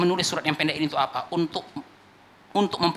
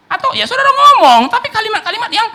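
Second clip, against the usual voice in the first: raised preaching; 11 dB louder than usual. Real, not acted. Preacher lifts his voice.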